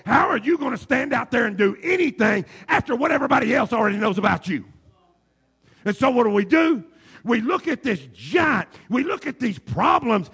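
loud, high preaching cry. Acted. Preacher shouting the sermon to the church. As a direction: preach it out to the congregation.

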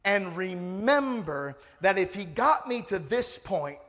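loud, slow preacher's build to a high shout; rally the congregation, punching each phrase; rising revival-style proclamation; full-force preaching. Real, not acted.

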